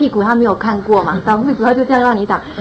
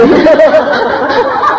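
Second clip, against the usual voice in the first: high, loud laugh out loud; burst of laughter; 6 semitones higher, 5 dB louder than usual. Real, not acted. Mother laughs while telling how her son copied her, high laugh. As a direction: laugh at the memory.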